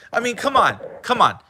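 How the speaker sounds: ordinary talk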